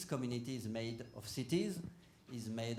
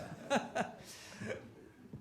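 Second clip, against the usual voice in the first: light airy laugh, pitched up; breathy high laugh; 12 semitones higher than usual. Real, not acted. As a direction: let out a high breathy chuckle. Laughs at compliment on youth.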